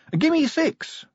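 very high, chirpy call; playful greeting pitched right up; cheerful. Acted. Recruit greeting an old acquaintance in the laboratory, cheerful. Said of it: "said Sally cheerfully".

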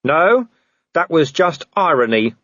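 shouted retort. Acted. Vimes correcting the werewolf from the tree, shouted. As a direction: shout it back, unimpressed. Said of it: "Vimes shouted".

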